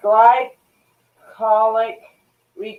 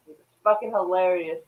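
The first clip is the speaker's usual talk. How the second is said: usual speaking voice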